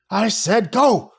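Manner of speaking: slow, high angry shout. Acted. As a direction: bark it furiously. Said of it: "Creepy Voice shouted angrily".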